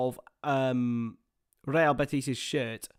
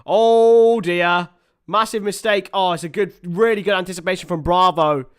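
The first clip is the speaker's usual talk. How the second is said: raised voice, louder and higher, reacting live; excited dismay; 5 semitones higher, 9 dB louder than usual. Real, not acted. Commentator seeing a massive mistake and a save, loud excited dismay.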